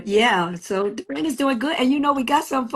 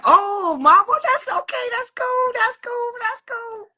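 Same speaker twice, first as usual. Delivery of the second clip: imitating her in a high-pitched voice; high mock voice, repeating quick reassurances; retold approval; 13 semitones higher than usual. Retelling her reaction to the video, in a high imitated voice.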